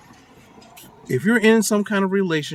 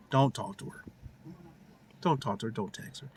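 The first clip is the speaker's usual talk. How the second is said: repeats getting softer and lower until barely voiced; fading insistence; 5 semitones lower, 10 dB quieter than usual. Real, not acted. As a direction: trail off into a whisper. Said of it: "I said".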